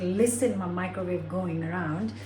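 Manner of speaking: ordinary talk, nothing marked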